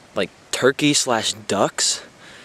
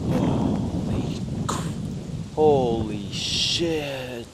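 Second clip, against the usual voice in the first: stunned whisper; much slower than usual. Real, not acted. Sudden shock at talking cats.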